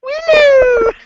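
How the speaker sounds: very high squealed character call; Willow. Retold or imitated. Wrestling fan mimicking Willow's silly voice, high chipmunk squeal. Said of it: "he sounds like a chipmunk".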